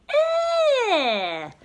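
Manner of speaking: high, loud yuck; squealed ew of disgust. A narrator's reading. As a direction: spit out a gross taste. Baby tastes mustard.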